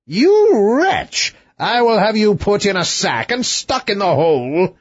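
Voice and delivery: high, furious royal threat; the king lashes out at the prince; angry king. Acted.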